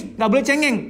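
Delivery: high, chiding "nggak boleh cengeng", like a strict parent; mock scolding parent. Parent telling a crying son "nggak boleh cengeng", mock scolding. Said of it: "kita berada pada kultur yang".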